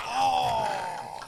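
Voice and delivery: high, loud playful "oh"; high hammed-up exclamation; mock shout. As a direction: cheer like a crowd at the grill. Imitating hibachi chef's show.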